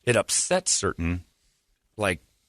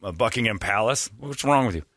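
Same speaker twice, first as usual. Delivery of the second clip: normal talking voice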